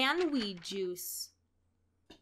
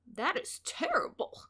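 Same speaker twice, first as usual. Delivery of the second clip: higher squawking duck voice; a lightly squawked reply; 5 semitones higher than usual. A narrator's reading. Ducky Lucky reacts to bad news, a light squawk. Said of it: "squawked Ducky Lucky".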